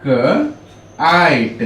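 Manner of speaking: काइट drawn out very slowly, sounds blended into one word. Shown as a demonstration. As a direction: stretch काइट out slowly for the class. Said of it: "सब मिला के पढ़ेंगे तो हम क्या पढ़ेंगे". (काइट को बहुत धीरे खींचकर, जोड़कर बोलना)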